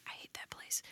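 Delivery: whispered aside. Real, not acted. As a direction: drop to a breathy secret whisper. Aside about a club.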